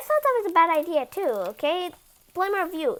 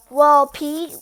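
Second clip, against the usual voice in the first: plain reading voice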